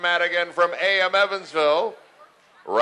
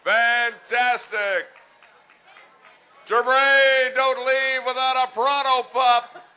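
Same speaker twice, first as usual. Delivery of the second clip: excited; 8 semitones higher, slower than usual. Real, not acted. Jokey call to football player.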